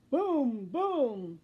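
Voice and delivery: loud booming drum; drum booms. A narrator's reading. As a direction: boom it like a big drum. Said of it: "Bean plays his drum".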